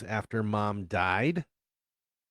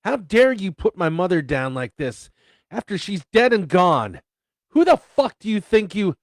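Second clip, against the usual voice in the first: screaming in rage, high and loud; furious shout; 9 semitones higher, 13 dB louder than usual. Acted. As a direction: yell it with fury. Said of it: "I spat".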